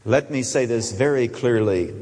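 usual speaking voice

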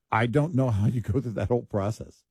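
ordinary talk, nothing marked

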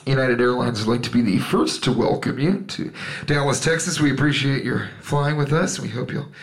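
imitated cabin address, low and measured; airline pilot announcement. Pilot welcoming passengers to Dallas, imitated low announcement. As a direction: play the pilot on the intercom, routine patter. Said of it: "the pilot always gets on and goes".

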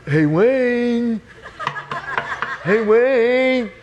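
high, drawn-out call through a door; pestering late-night holler, high and held long; Mike calling out. Acted. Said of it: "then I hear this voice".

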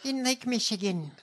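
hammed-up imitation of a candidate's anecdote; politician send-up. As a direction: exaggerate her folksy anecdote, high and airy. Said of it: "If Lily Tomlin would have done this".

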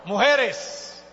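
loud, high count-in; rousing crowd-leader's call; rallying the crowd. Real, not acted. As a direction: shout the cue to the whole congregation. Cues women, then men to shout.

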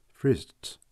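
plain reading voice

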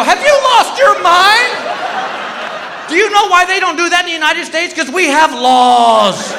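high-pitched indignant rant; mock outrage, stretching the last word out; high, incredulous scolding; outraged. Outraged reply in retold quarrel.

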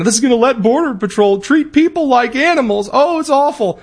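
high, hammed-up outraged voice; sarcastic mock outrage; mocking the left. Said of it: "the left is suggesting".

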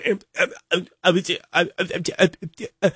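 mock stuck stammer on em; hammed-up stammer unable to speak. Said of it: "the whole interview I'm going".

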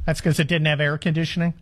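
ordinary talk

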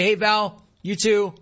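high friendly reply; a lightly voiced cheery reply to the barista. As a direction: answer her cheerfully. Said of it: "Hi, [name]".

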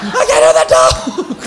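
hammed-up deaf shriek; mock shout. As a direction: yell like you've gone deaf. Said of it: "You're like".

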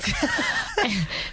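a sudden high burst of laughter. Real, not acted. Guest laughing at the host's presentation, high burst of laughter. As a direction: let the laugh jump up high. Reacting to her own joke.

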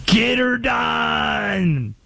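hammed-up Southern accent catchphrase. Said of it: "It's always the Southern accent".

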